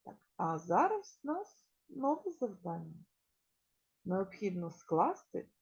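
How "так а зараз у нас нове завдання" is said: normal talking voice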